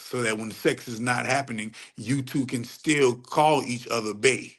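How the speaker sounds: usual speaking voice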